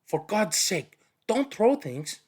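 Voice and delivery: put-on a girl pleading from hiding; girl begging the thrower to stop; girl's voice. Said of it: "It was a girl's voice".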